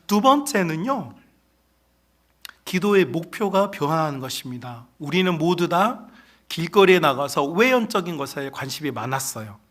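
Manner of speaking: ordinary delivery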